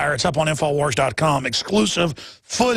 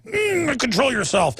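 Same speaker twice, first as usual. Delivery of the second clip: a quick mock Strangelove, the "Mm" jumping up high; lightly hammed-up mad scientist, reining himself in; Doctor Strangelove impression; 6 semitones higher, slower than usual. Host mocking himself, half-heartedly doing a hint of Doctor Strangelove. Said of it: "It's like Doctor Strangelove".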